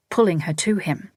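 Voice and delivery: plain reading voice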